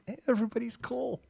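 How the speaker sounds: ordinary delivery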